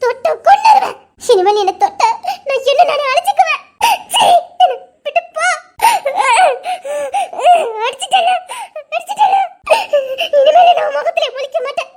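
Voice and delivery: high, loud tearful yelling; crying rage. Acted. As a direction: sob and shout at him through tears. Woman sobbing and yelling after slap. (அழுதுகொண்டே கணவனை நோக்கி உரத்த கோபக் கத்தல்)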